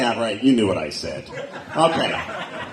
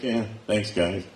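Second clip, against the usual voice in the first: muttered sarcastic thanks; 8 semitones lower, 7 dB quieter than usual. Retold or imitated. Sarcastic reply to neighbors.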